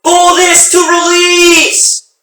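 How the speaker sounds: drawn-out bellowing command; slow shout. Acted. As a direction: bellow it slowly over the noise. Crewman shouting in helicopter.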